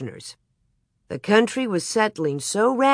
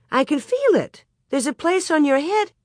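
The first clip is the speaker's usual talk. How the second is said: alarmed; 10 semitones higher than usual. A narrator's reading. Ma alarmed in bed.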